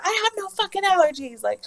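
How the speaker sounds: high, loud retold outburst; exasperated yelling. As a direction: yell back, fed up and desperate. Acted fed-up yelling back at staff.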